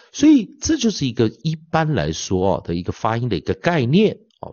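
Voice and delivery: ordinary delivery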